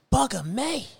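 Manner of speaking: high-pitched mock British accent. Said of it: "if you had the British accent".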